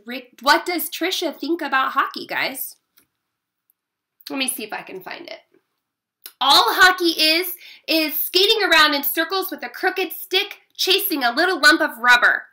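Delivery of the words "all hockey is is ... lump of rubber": loud, high scornful rant; bellowing. A narrator's reading. Sister mocks brother's hockey, bellowing. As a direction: bellow a sibling put-down. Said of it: "I bellowed".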